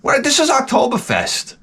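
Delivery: half-hammed disbelieving outburst; incredulous scoff. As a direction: scoff like it's beneath you. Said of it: "I'm like".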